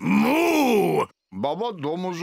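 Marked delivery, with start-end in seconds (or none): 0.0-1.1 a big, loud, high bull's moo. Acted. Bull friend arrives at the door and moos loudly. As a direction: bellow it like a bull.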